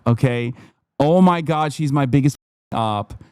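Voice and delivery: ordinary talk, nothing marked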